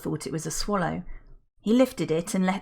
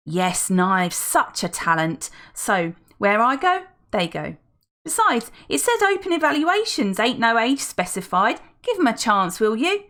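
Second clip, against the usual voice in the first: louder, pushy chatty lady; gushing older woman; 4 semitones higher, 5 dB louder than usual. Acted. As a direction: talk the butler round. Felicity argues for the twins.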